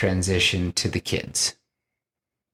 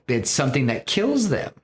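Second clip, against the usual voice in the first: ordinary talk, nothing marked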